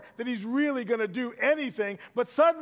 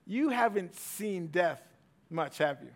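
quiet gentle mentor; 5 semitones lower, 4 dB quieter than usual. Retold or imitated. Willard to Greg in the car, quiet and gentle. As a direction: ease into it like a patient old friend.

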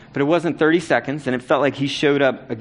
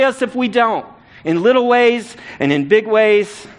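higher and louder, driving each phrase home; preaching at full force; 6 semitones higher, 4 dB louder than usual. Acted. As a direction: preach it like a rallying call to the church. Preaching climax, how to forgive.

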